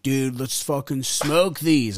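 lightly voiced slow frat bro; frat guy. Frat friend proposing a smoke, lightly mocked slow bro voice. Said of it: "my fucking frat guy friend".

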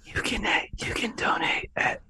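whispered donation plea, struggling with the voice. Pretend. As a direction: keep it all in a whisper. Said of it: "I can't whisper".

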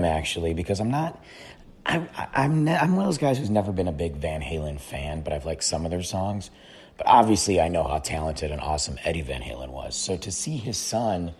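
usual speaking voice

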